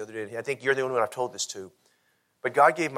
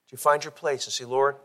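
altar call. Acted. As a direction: invite them gently to the altar.

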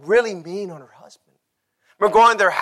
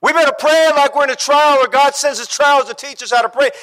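raised, emphatic pulpit cry; full-force preaching; 9 semitones higher, 6 dB louder than usual. Shown as a demonstration. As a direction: hammer the saying home high. Same saying repeated at full force.

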